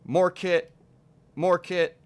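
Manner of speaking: high, loud bursts with gaps between; mimicking repetitive edits. Mocking editors who repeat tight shots, high punchy mimicry. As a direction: punch out each phrase like another video cut. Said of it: "And they'd be like".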